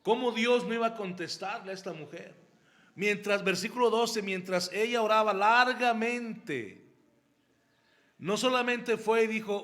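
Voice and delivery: ordinary talk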